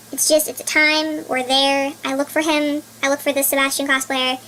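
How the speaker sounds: normal talking voice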